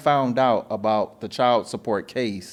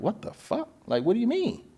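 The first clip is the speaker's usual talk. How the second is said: shocked disbelief; 10 semitones higher, 5 dB quieter than usual. Retold or imitated. Retold shock at agency.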